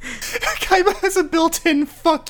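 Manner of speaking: high, loud laughing yell; laughing shout. Real, not acted. Mocking Kaiba to friends, high laughing yell. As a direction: bellow it through laughter.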